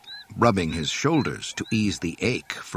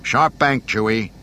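loud, raised command to the copilot; ordering; 3 semitones higher, 7 dB louder than usual. A narrator's reading. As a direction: call out an urgent order. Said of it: "he ordered".

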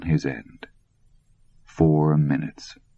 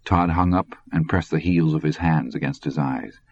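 plain reading voice

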